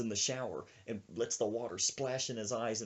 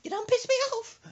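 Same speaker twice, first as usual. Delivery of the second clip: loud, very high squawk; mock-irate granny yell; fuming old woman; 7 dB louder than usual. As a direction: burst out in angry falsetto. Old woman yelling back inside.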